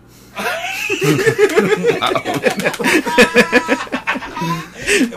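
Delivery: big squealing laugh; long high laugh. Real, not acted. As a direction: burst out laughing.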